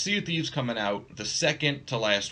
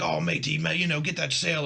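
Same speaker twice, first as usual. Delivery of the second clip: pirate voice; 6 semitones higher than usual. Retold or imitated. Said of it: "you get into that pirate mode".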